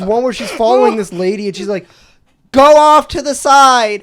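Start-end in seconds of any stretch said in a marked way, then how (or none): 2.5-4.0 high, loud and drawn-out demand; slow, high-pitched mock holler; mock indignant yell. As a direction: imitate a woman yelling someone out of her path. Acting out her yelled demand.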